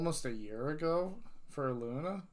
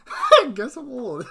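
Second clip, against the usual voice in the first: much higher and louder, through a laugh; giggling admission, pitched up; 11 semitones higher, 14 dB louder than usual. Real, not acted. Streamer laughing at herself over chat, high and loud.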